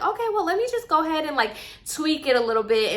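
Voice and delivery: high, hurried start, easing down; playing it off. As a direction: imitate an instructor breezily covering for a mistake. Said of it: "instructor's trying to play it off too".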